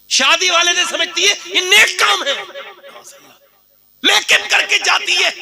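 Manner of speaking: high mocking preacher scorn; preacher mocks brides who wear makeup; scornful. Real, not acted. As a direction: sneer at the made-up bride.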